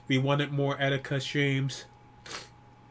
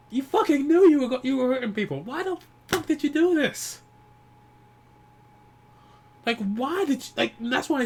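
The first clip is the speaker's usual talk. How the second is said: high, loud pained demand; real anguished anger; grieving outburst; 9 semitones higher, 3 dB louder than usual. Real, not acted. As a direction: demand an answer from a friend who's gone. Grieving fan confronts dead streamer.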